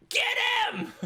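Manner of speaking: very high, loud scream. Acted. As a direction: scream the signal to attack, laughing after. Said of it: "Like, screams it out".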